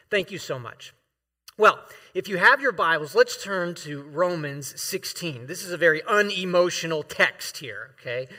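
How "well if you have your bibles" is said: normal talking voice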